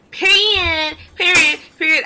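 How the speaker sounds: high, punched repeats of a pet word; high mock-emphatic declaration; imitated catchphrase. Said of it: "It's like her catchphrase".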